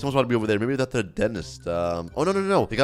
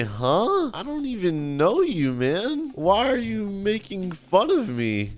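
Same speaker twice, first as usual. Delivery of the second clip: higher, hammed-up hurt victim; wounded protest; 6 semitones higher, slower than usual. Bullied customer to his bully, wounded protest. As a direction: plead in baffled, put-on hurt.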